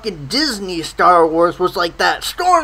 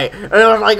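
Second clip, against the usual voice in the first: ordinary delivery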